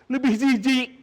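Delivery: disgust. A narrator's reading. Sufi disgusted by the dirt.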